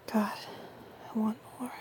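hushed hungry whisper. Acted. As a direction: breathe the wish out in a whisper. Intimate roleplay, desire rising.

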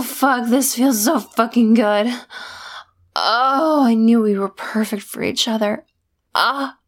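usual speaking voice

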